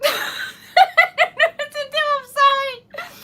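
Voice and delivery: high, loud laugh-cry; helplessly laughing through the words, voice shooting up; overwhelmed tearful laughter. Real, not acted. Overwhelmed by a surprise donation.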